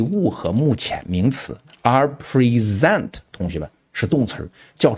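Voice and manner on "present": preSENT, second syllable of present stressed and drawn out; 第二音节重读. Shown as a demonstration. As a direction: say present as pri-ZENT, slowly. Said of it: "记住啊，重读在第二音节". (present后音节重读拉长)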